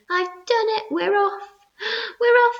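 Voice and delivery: high, loud cry of victory; triumphant shout. A narrator's reading. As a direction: crow it through the hole. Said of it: "and shouted".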